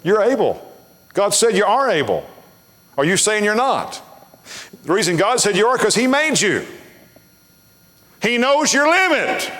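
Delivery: high, ringing pulpit exhortation; preacher building in pitch to the final line; emphatic preaching. Real, not acted. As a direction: drive each short line home, rising to a cry. Preacher exhorting at full force.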